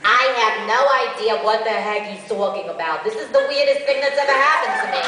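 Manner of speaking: loud, bewildered character voice; loud, disbelieving complaint; loud confused demo character; baffled character. Shown as a demonstration. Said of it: "I have, like, a little snippet".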